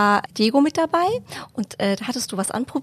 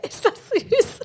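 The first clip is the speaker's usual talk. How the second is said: laughing; 12 semitones higher, much slower than usual. Real, not acted. Host charmed by the anecdote.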